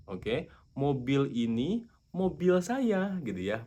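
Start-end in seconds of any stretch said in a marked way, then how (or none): 0.7-3.2 slow, higher dictation; slow, higher example. Shown as a demonstration. Teacher dictating a new example slowly. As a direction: read it out slowly and brightly. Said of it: "ada kalimat".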